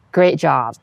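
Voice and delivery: normal talking voice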